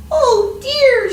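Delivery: high, loud worried cry; dismayed sigh. Acted. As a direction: fret like a tiny bug. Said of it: "she sighed".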